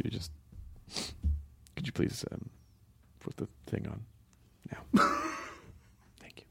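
hushed whisper request. Shown as a demonstration. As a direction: whisper it softly, almost unvoiced. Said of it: "I just did radio voice".

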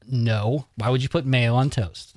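ordinary delivery

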